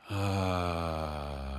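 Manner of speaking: full open ah on the exhale; loud voiced sigh. Shown as a demonstration. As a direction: release a loud sung-out ah. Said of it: "each breath and each sigh".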